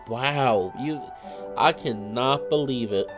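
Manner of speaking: ordinary talk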